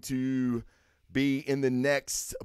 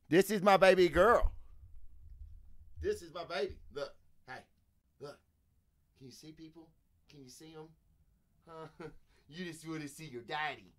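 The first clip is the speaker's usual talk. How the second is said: soft coaxing pet voice, much quieter; 8 dB quieter than usual. Real, not acted. Owner softly coaxes his dog to greet viewers. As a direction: coax the dog gently.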